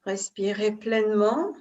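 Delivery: ordinary delivery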